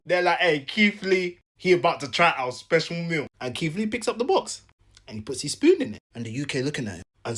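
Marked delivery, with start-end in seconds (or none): none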